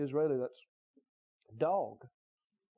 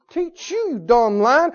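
scolding triumph; 7 semitones higher, 7 dB louder, slower than usual. Acted. Acting David beating the lion.

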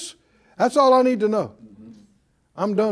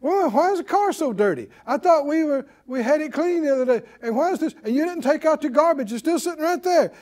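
high-pitched nagging act; mock griping; 5 semitones higher than usual. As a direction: nag in a raised pitch, one complaint after another. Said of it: "many people are used to griping".

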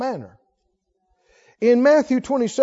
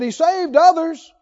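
high, raised-voice taunt; mocking; 6 semitones higher, 4 dB louder than usual. Chief priests taunting Jesus on the cross, mocking. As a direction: jeer at someone who claims to be king. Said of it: "They're mocking him".

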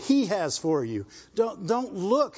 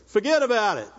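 imitating a New York catchphrase; amateur New York accent for a running joke. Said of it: "don't do New York".

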